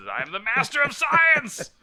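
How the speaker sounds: high, loud, drawn-out triumphant holler; re-enacted scream. Retelling his boast at the microbiology building, high scream. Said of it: "and I screamed".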